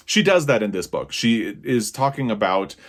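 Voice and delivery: ordinary talk, nothing marked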